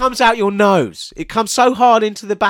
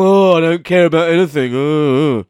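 mocking the co-host; 4 semitones lower than usual. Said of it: "he's gone all".

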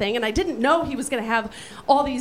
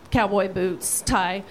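ordinary talk

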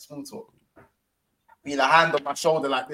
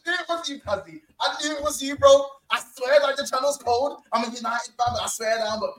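high, gushing mock Birmingham accent; Birmingham fan's accent; 6 semitones higher than usual. Retelling a tipsy fan praising his channel, high exaggerated Birmingham accent. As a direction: lay the Birmingham accent on thick, as an excited fan. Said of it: "the accent's hilarious to me".

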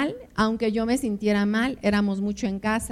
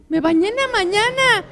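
whiny husband; 10 semitones higher, 6 dB louder than usual. Retold or imitated. Husband protests the demand to bathe.

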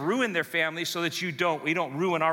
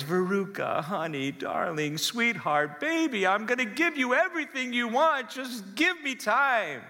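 much higher, syrupy pleading dad, slowing right down at the end; doting weak dad; 5 semitones higher than usual. Acted. Dad promising his spoiled daughter everything, higher and sugary pleading. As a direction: coo and beg like a dad who caves to everything. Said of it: "To which dad replies".

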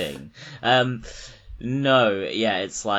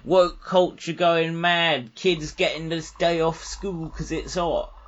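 higher, mocking old-timer rant; higher, loud, sneering parody of a reactionary; mock right-winger; 10 semitones higher, 3 dB louder than usual.

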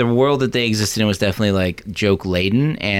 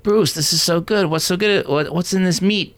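raised pitch, delighted diners; high Italian diner voice; 9 semitones higher than usual. Retold or imitated. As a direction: gush about the food, high. Voicing Italian diners in movie.

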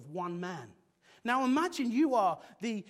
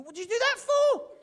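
much higher, louder laughing shriek; horrified squeal; 10 dB louder than usual. Pretend. Single friend learning their photo was shown, shrieking with a laugh. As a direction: panic that they've seen your photo. Single person voiced, alarmed.

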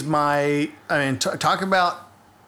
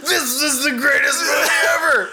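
high overjoyed shout; excited shout; 9 semitones higher, 3 dB louder than usual. Retold or imitated. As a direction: cry out in mock delight. Mock overjoyed cry at movie.

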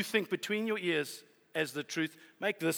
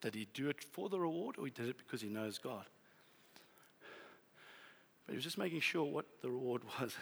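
ordinary delivery

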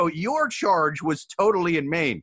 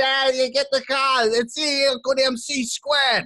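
put-on crazy Massachusetts accent; 8 semitones higher than usual. Podcaster showing how crazy the locals sound, crude Massachusetts accent. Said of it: "they sound crazy".

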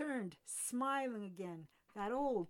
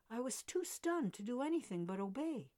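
ordinary delivery

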